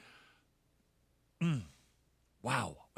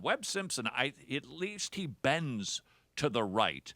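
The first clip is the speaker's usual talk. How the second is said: ordinary talk, nothing marked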